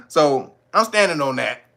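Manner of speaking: normal talking voice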